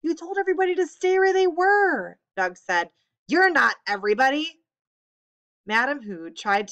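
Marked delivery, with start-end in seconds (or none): 0.0-2.2 loud, high protest; indignant retort. Acted. Doug talking back to his father, indignant. 3.3-4.6 loud, sharp reply; angry rebuke. Acted. Hu rebuking his son Doug, angry.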